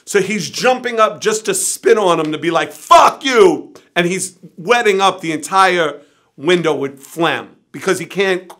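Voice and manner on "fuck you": high, loud imitated outburst; furious yell. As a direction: yell it while jumping to spit at him. Bill spitting at locked-up brother.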